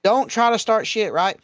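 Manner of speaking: pointed admonishment; emphatic lecturing. Real, not acted. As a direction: lay down the law firmly. Laying down rules for convention goers.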